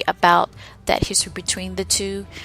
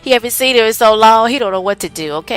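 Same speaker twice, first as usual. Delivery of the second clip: louder, fast, heated commentary; worked-up rant; 2 semitones higher, 7 dB louder than usual. Real, not acted. Host riffing to listeners about Rulon, loud and worked up. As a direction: get fired up explaining it.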